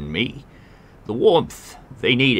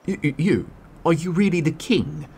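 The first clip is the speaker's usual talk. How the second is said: higher, stammering disbelief; stunned stammer; 9 semitones higher than usual. Acted. Beggar realizing the stranger is the king, stammering awe. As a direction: trip over the words in awe.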